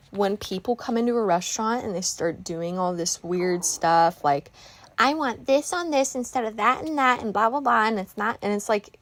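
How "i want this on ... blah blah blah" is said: higher-pitched, dismissive mimicry of a picky order; lightly mocking imitation of a demanding diner; mocking fussy customer. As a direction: reel it off in a mocking voice.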